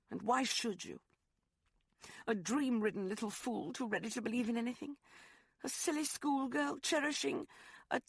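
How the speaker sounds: mock her own foolish dreams; Rosalind, bitter. Woman scorns herself as a silly schoolgirl, high and bitter.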